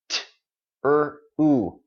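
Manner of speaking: "T, er, u" as three separate phonemes; sound by sound. Shown as a demonstration. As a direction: sound out "T, er, u" piece by piece. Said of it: "let's look at it in I P A".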